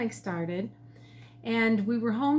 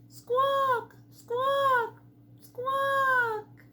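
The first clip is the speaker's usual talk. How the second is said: high squawking bird call; pheasant squawk; much slower than usual. A narrator's reading. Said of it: "he called out again".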